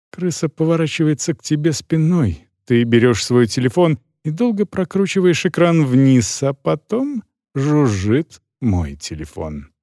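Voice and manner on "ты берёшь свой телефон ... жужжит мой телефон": usual speaking voice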